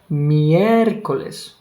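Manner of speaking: Miércoles slow with the stress held hard on the mié; exaggerated stress. Shown as a demonstration. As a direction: lean heavily on the mié of Miércoles, slowly. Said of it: "where is the accent?".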